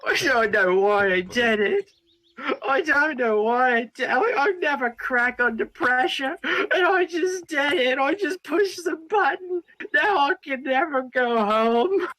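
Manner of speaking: high, rising, near-tears panic; breaking down. Acted. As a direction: voice climbing into a sobbing wail. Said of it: "start fully breaking down".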